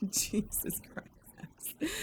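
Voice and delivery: whispered and very slow, drained; breathless whisper. Real, not acted. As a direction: let it out on a spent breath. Exhausted after laughing fit.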